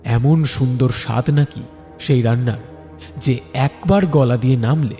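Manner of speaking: ordinary delivery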